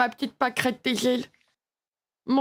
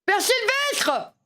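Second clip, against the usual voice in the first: higher, slow drawn-out call; 9 semitones higher, much slower than usual. Acted. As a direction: yell his name across the house. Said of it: "arrête de gueuler".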